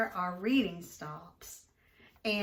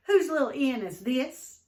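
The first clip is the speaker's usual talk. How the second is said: loud, angry demand; bellowing; 5 semitones higher, 8 dB louder than usual. A narrator's reading. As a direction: bellow it at the girl. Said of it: "bellowed Mister Barnett".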